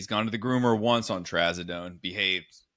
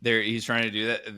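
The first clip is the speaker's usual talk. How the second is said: ordinary delivery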